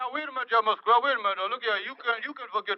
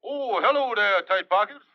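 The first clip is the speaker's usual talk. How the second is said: Kingfish voice; 2 semitones higher, 6 dB louder than usual. Acted. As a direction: taunt the stingy boss on the phone. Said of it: "when I'm playing the Kingfish".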